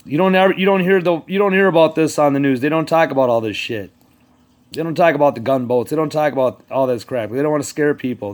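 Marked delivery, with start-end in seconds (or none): none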